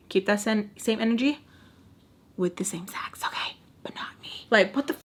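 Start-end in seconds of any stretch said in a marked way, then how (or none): 2.4-5.0 hushed, breathy aside; whispered aside. Real, not acted. A whispered jab at the guy to her viewers. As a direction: drop to a whisper, just between us.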